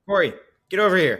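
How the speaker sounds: high, loud, fast yell across the store. Acted. Player calling Corey across the store, loud yell. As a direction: shout her name across the shop. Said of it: "I yell at her".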